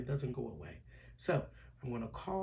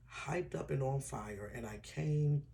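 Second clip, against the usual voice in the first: ordinary delivery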